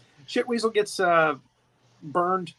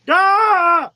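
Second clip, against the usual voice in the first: mock yelp; 11 semitones higher, 9 dB louder than usual. Kid grabbed, yelling out.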